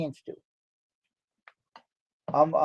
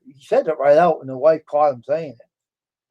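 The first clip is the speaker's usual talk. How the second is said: ordinary talk, nothing marked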